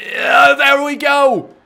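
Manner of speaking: slow, high, loud shout; stretched victory yell; triumphant shout. Real, not acted. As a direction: roar it out in triumph. Big move lands in match.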